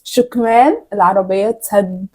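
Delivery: slow, carefully mouthed; slowly. Shown as a demonstration. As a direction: draw each word out for lip reading. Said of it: "بالراحة".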